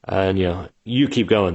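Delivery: ordinary talk, nothing marked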